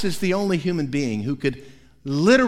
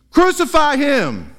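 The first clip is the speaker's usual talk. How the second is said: loud, high demanding cry; crowd shout; 9 semitones higher, 6 dB louder than usual. Acted. Crowd shouting at Pilate for crucifixion, loud high cry. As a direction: yell it as the angry mob.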